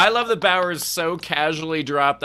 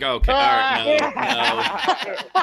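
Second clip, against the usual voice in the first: high, loud, hurried refusal; retold alarmed cutoff; 11 semitones higher, 5 dB louder, much slower than usual. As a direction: cut him off in mock alarm. Retold comic cuts off offensive joke.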